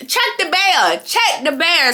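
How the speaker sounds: high, urgent calls to the room; mock-frantic plea for security to check the bear; imitating a desperate shout over the noise; calling out for attention. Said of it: "trying to get everybody else's attention".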